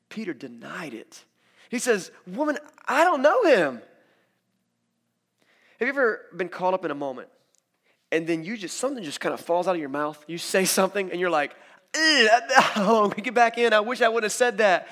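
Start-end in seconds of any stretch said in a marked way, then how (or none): 2.3-3.8 loud, high, indignant denial; emphatic denial. Acted. Peter to the servant girl, loud protest. 11.9-14.9 high, loud comic cringe; mock regret. Someone who just said something wrong, loud cringing regret. Acting out regret over blurted words.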